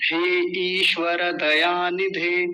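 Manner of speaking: हे ईश्वर दयानिधे slowly, long ee and full ra; correct mantra pronunciation. Shown as a demonstration. Teacher quoting an evening-prayer mantra, slow careful recitation. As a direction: recite हे ईश्वर दयानिधे with every vowel sounded. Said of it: "संध्या में एक मंत्र आता है".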